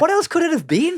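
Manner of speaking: high, loud comic exasperation; exasperated outburst. Retold or imitated. Comic railing at bar staff, exasperated and high. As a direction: pitch it right up in loud disbelief.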